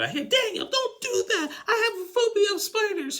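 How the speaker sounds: very high laughing squeal, imitating; mock screaming. Lisa screaming at Daniel over the spider, very high. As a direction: squeal it through laughter, hammed up. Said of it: "then she's screaming".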